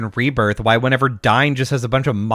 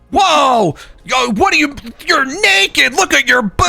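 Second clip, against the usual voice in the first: mock shocked shout; 11 semitones higher than usual. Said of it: "then they're like".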